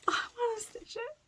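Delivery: high, squealing little voice of delight; gushing over a pretty sampler in the shop, squealing. Retold or imitated. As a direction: squeal it like you just fell in love with it. Her excited thought in shop.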